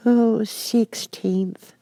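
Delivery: high, very slow sleepy drawl; drowsy Dormouse adding a date; sleepy little Dormouse. Acted.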